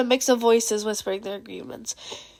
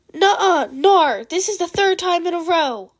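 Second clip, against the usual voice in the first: high, loud whiny objection; indignant protest; 6 semitones higher, 4 dB louder than usual. Acted. Mei protesting the mushroom chair dibs, high and whiny. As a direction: refuse it like a sulky friend.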